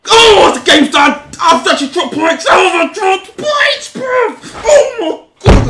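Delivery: loud, high, cracking shout of despair; anguished shout. Real, not acted. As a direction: yell it like the match just slipped away. Drops points, real outburst.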